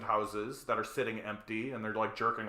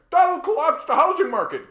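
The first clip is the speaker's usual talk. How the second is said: much higher, louder panicked whine; mock the critic's shrieking worry; hammed-up panic about the market; mock alarmed landlord; 10 dB louder than usual.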